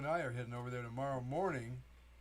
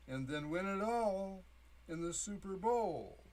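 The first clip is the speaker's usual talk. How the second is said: slow, lilting recitation, pitched up; poetry reading; 5 semitones higher, 3 dB louder, slower than usual. Acted. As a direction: recite it slowly like a verse. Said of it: "and the poem goes like this".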